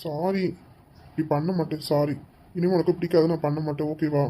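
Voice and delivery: low, gentle pleading sorry; soft coaxing apology. Acted. Husband softly coaxing his angry wife, gentle pleading sorry. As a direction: coax her softly, almost murmuring.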